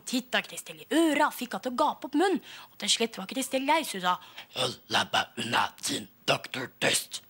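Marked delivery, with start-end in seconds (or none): 4.6-7.2 put-on girl telling off the doctor, low and rude; cheeky strange voice. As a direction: brush the doctor off in a deep monster rumble. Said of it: "Litt frekk".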